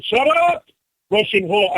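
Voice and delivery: high, loud shout off the phone; angry shout. Acted. Caller shouting angrily at his wife off the phone. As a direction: bark it at someone in the room.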